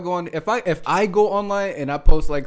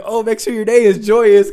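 mock fake positivity; 5 semitones higher, 4 dB louder than usual. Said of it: "I'm not gonna sit here and tell you".

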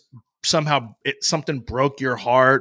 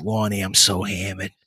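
put-on lower, drunken thick Boston accent; 7 semitones lower, 4 dB quieter than usual. Gary's drunk voicemail to Lonnie, lower, thick Boston accent. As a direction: sell it like a hammered Boston kid. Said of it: "this thick Boston".

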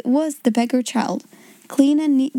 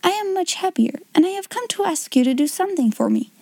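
Sarah's voice; 7 semitones higher than usual. A narrator's reading. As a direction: lift into a young girl's voice. Sarah asks a favour.

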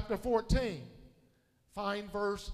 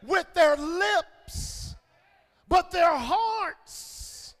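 loud, higher preaching with stretched key words; forceful preaching; 7 semitones higher, 8 dB louder, slower than usual. Real, not acted. Preacher warning church about lip-service love, loud forceful preaching. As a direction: drive it home to the congregation, loudly.

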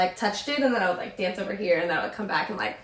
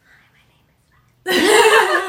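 whispered then a laughing squeak; shy whisper trailing into a laugh; timid whisper; 10 semitones higher, 8 dB louder than usual. Retold or imitated. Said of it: "Like, literally like that".

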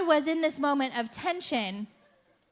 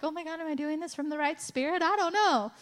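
fast, higher, fretting voice; mock anxious worry; 4 semitones higher, faster than usual. A believer mocking her own fear of wrong motives, fretful. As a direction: rush it like a worrier. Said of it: "be fearful of".

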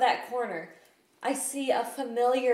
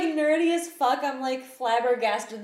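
ordinary delivery

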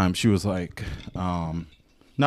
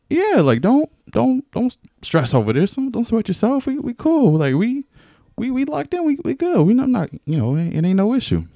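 mock reassuring. Reassuring his nervous partner, voiced.

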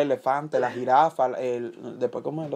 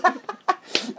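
high squeal of laughter. Real, not acted. Laughs at joke.

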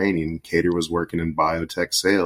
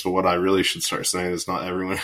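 usual speaking voice

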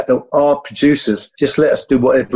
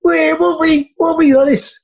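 excited fans. Retold or imitated. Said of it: "people are going".

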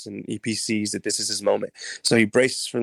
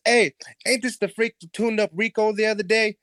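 higher, mocking thug voice; a jeering sidekick sizing him up; henchman voice; 11 semitones higher than usual. Henchman jeering to his friend, mocking higher voice.

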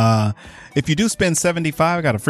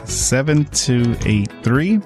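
ordinary talk, nothing marked

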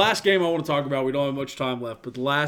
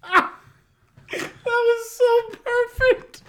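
higher and louder, slowly squeezed out through laughter; barely getting it out while cracking up; laughing; 6 dB louder, much slower than usual. Real, not acted. Laughing at perfect slip.

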